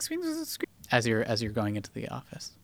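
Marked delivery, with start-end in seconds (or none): 0.0-0.7 high squeaky rat noises; rat squeaks. Acted.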